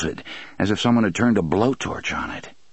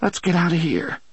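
trembling voice; 10 semitones higher than usual. A narrator's reading. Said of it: "in a trembling voice".

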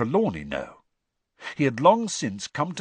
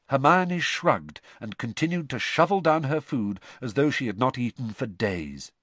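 ordinary delivery, nothing marked

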